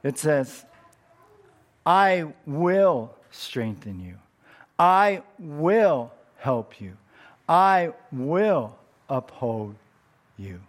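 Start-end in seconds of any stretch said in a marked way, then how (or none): 1.8-10.6 "I will" drawn out slowly, each line weighty and louder; stress on I WILL. Shown as a demonstration. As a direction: slowly read the verse, leaning on "I will". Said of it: "If God emphasizes "I will" three times".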